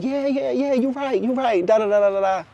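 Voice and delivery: high, singsong brush-off; mock-placating chant trailing into nonsense; insincere agreeing. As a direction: mimic someone only pretending to listen. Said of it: "make you think that they listening to you".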